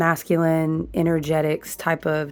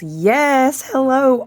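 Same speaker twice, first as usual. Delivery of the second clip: excited hello; 7 semitones higher, 4 dB louder, much slower than usual. Real, not acted. Excited greeting to viewers.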